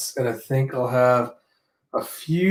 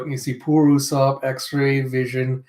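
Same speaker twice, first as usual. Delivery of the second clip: ordinary delivery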